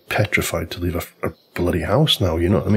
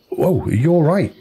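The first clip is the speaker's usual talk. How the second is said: startled; 10 semitones higher than usual. Retold or imitated. Retold alarm at neighbour's.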